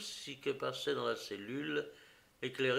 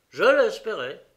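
defiant admission; 8 semitones higher, 13 dB louder than usual. Acted. Prisoner admits hope.

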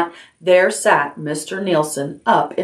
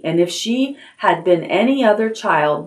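plain reading voice

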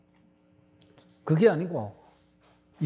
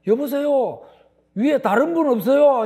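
mock shout up the cliff, higher and louder; calling out; 7 semitones higher, 6 dB louder than usual. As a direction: call up the cliff for someone else. Man calls out upward. (절벽 위를 향해 외치는 사람, 과장된 외침)

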